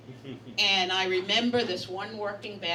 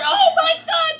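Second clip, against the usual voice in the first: much higher and louder, a startled exclamation; shocked cry; 13 dB louder than usual. Retold or imitated. New worker to the room after being groped, shocked cry. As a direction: yelp it like you've just been grabbed. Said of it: "I said".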